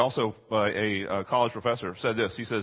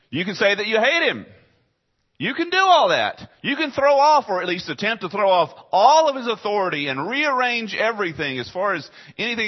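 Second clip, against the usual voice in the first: high, loud preacher's cry building up; fiery preaching; 9 semitones higher, 6 dB louder than usual. Real, not acted. Preacher crying out to the congregation, fiery and loud.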